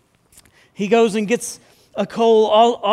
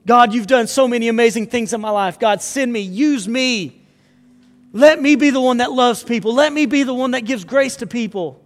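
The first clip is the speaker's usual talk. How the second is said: louder, rising prayer at full fervor; fervent preaching; 4 semitones higher, 5 dB louder than usual. Acted. Pastor urging the congregation to answer God, fervent and loud. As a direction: pour it out as a plea to be sent.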